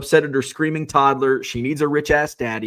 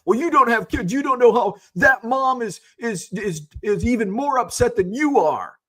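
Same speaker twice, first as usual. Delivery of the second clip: much higher, louder mocking voice of know-it-all commenters; mocking chat commenters; 11 semitones higher, 4 dB louder than usual. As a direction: parody the preachy chat reply. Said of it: "You're gonna type".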